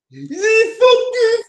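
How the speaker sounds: very high squealing whiny mock voice, half crying; mocking clown voice. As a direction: whine it out like a sobbing clown. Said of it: "You sound like Judah Tribe".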